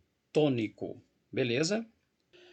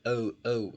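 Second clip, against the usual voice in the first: "O, O" isolated as the strong syllable of open; "O, O" repeated to show the stressed syllable; stressed first syllable; 2 semitones lower than usual. Shown as a demonstration. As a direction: sound out the stressed "O, O" on its own. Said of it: "é a primeira que é forte".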